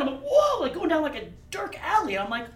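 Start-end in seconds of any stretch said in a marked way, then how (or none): 0.2-0.6 high loud startled whoa; alarmed whoa. Retold or imitated. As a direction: cry out in alarm. Alarm at friend's move.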